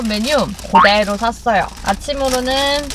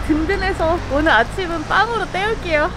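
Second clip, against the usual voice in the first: muffled behind mask; speaking with covered mouth; through a face mask; 6 semitones higher than usual. Real, not acted.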